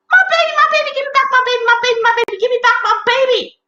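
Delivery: very high, loud, panicked cries; shrieking, repeated plea; frantic dad. Acted. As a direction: shout desperately while chasing after them. Dad chasing his stolen baby.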